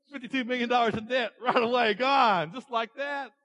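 loud, high-pitched jubilation; excited servant. Acted. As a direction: crow about the debt being wiped out. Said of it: "He was so excited".

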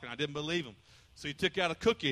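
ordinary talk, nothing marked

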